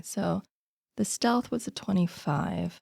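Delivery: usual speaking voice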